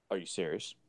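mock him as a pompous prick. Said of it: "pompous prick when he talks like that".

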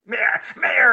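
loud high cry of mayor; mock cry. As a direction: wail it through a laugh. Echoing the shouted bit.

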